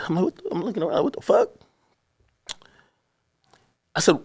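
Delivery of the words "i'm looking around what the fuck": ordinary talk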